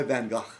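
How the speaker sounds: "Gogh" as "gah" in an amateur Dutch accent; "Van Gogh" with open ah, roughly Dutch; attempted Dutch pronunciation. Shown as a demonstration. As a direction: have a go at Dutch "Van Gogh", ending "gahkh". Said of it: "I'm not getting it right even".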